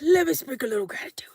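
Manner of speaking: a fast, whispered blessing; quick whisper. Pretend. Blessing viewers before bed.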